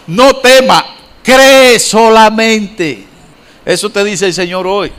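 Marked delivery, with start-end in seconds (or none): 0.1-3.1 louder, higher "No tema, cree solamente", drawn out very slowly; emphatic quote. Acted. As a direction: proclaim it, stretching every word. Repeats the same words stronger.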